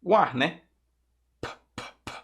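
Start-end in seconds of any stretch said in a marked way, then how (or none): 1.4-2.1 bare puffs of air for "P"; voiceless P sound. Shown as a demonstration. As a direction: say "P, p, p" as soft, separate pops with no voice. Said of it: "O som é produzido só com". ("P, p, p" só com o ar, sem voz)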